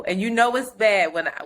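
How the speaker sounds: normal talking voice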